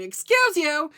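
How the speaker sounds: sharp, loud, high scold; scolding the cat. Retold or imitated. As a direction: tell off a cat that ran under the tire. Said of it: "I start to scold the cat".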